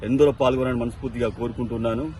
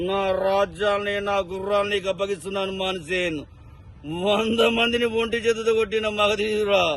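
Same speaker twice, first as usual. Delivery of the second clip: Srihari voice; 6 semitones higher than usual. Retold or imitated. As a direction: proclaim it like a swaggering film hero. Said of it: "Srihari voice ని ఉంటే ఎలా ఉంటుందో చూద్దాం".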